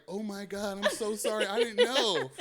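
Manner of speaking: high, apologetic woman's voice; apologetic girl voice. Retold or imitated. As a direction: gush out a flustered sorry. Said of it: "the S G Row was just like".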